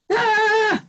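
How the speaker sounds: high, angry yelp of frustration; angry frustrated cry. Retold or imitated. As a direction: vent a sharp burst of road rage. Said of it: "and I go".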